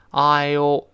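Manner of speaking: "I O" drawn out slowly with a full opening vowel. Shown as a demonstration. Teacher showing the full contraction, slow and drawn out. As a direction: say "I O" slowly, stretching it. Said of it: "是慢慢读".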